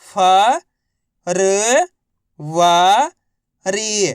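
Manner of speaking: राजनीतिक drawn out one syllable at a time. Shown as a demonstration. Teacher sounding out राजनीतिक for learners. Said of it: "तो बताइए मिलके क्या बनेगा".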